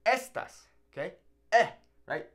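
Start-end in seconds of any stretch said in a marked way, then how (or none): 0.0-0.7 "estas" as ES-tas, pitched high; stress on first syllable. Shown as a demonstration. Teacher correcting after the wrong example, "estas" stressed on ES. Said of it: "No, it's". 1.5-1.7 "Eh" alone, higher and louder; stressed first vowel. Shown as a demonstration. Teacher isolating the stressed first sound, a strong "Eh". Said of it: "No, it's".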